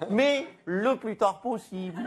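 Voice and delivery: slow. Acted. Repeated slow punchline.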